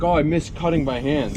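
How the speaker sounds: loud mock whiny voice; lightly voiced complainer; mocking whiner.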